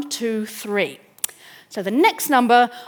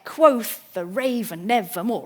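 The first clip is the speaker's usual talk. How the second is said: slow strong-weak beat through "Quoth the raven nevermore"; trochaic beat; slower than usual. Shown as a demonstration. Lecturer showing the trochee with a Poe line, slow stressed-unstressed beat. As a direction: stress "Quoth", "ra-", "ne-" and "more", easing between. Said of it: "Stressed, then unstressed".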